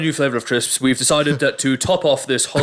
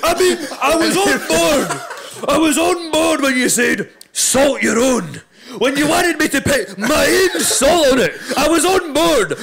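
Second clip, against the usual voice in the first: high, slow, laughing so hard it nearly turns to crying; barely getting words out through laughter; squeaky laugh-choked rant; 10 semitones higher, slower than usual. Real, not acted. Cracking up mid-rant.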